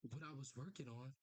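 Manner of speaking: low, measured dramatic read; comic narration. Acted. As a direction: narrate gravely, leaving pauses between phrases. Reads comic narration aloud.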